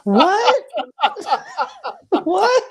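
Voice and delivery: high, loud, laughing disbelief; shocked laughing what. Real, not acted. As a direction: shriek it through a laugh. Hosts react in disbelief.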